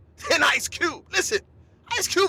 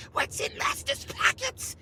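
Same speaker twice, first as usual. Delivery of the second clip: whisper; 10 semitones higher, 7 dB quieter than usual. Pretend. Quick mock aside.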